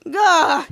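short, loud acted grunt; mock cry on being hit; knocked-over yell. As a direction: blurt a loud "gah". Villain knocked out the door.

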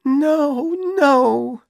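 put-on a girl breaking into tears; Dorothy crying as she refuses her boyfriend; starting to cry. A narrator's reading. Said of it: "She started to cry".

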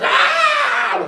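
high, loud mock scream; sudden piercing "ah"; imitated scream. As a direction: shriek as if set on fire. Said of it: "She started screaming kind of like that".

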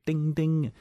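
high sing-song ding ding; playful high tram-bell ding; trolley bell imitation. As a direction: chime it like an old tram bell.

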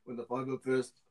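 restarted clone narration in mock New Zealand accent; bad New Zealand accent. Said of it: "how bad this accent is".

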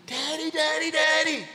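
put-on high, loud little girl screaming for her father; frightened child scream. Daughter in the back seat screaming for daddy, terrified. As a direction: shriek it like a child terrified of a bee. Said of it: "she was screaming".

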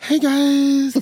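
high, bright sing-song greeting; upbeat youth pastor. Acted. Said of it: "She sounded so nice today".

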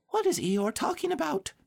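tiny, very high small-animal voice; Piglet whispering. A narrator's reading. Piglet to Pooh during the speech, high whispered squeak. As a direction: squeak it as a confidential aside. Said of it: "Piglet whispered to Pooh".

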